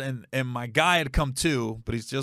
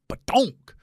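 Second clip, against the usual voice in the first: head bounce noise; 9 semitones higher than usual. Retold or imitated.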